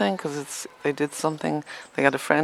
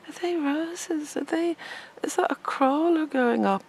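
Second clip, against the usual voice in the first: high, slow, sugary imitation of the neighbour; mock the prying neighbour lady; nosy neighbour; 11 semitones higher than usual. Mimicking the neighbour peeping over the fence, high and slow.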